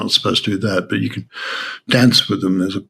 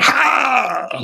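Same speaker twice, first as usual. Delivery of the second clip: a sudden high shout of Ha, much higher in pitch; roared shout. Retold or imitated. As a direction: let one explosive Ha burst out at the teacher. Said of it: "I just yelled".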